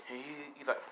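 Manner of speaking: ordinary delivery, nothing marked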